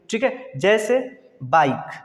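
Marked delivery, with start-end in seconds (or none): 1.4-2.0 "बाइक" ending on the k, E silent; correct reading. Shown as a demonstration. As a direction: say "बाइक" as one syllable with no final vowel. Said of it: "सिर्फ बाइक".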